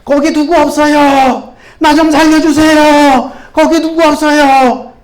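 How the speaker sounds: loud slow cries for help; a man hanging off a cliff calling out in fear; panicked traveler shouting for help; frightened shouting. Acted. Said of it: "겁에 질려 소리 칩니다".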